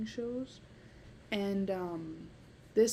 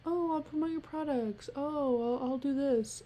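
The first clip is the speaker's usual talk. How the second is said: higher-pitched imitation of an influencer pitching brands; mock influencer voice, pitched up; mock eager influencer; 6 semitones higher than usual. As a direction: put on a sing-song sponsor-chasing voice. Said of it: "contact businesses and I'm like".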